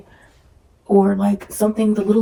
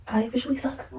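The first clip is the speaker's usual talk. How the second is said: whisper; 4 semitones higher, 6 dB quieter, faster than usual. Real, not acted.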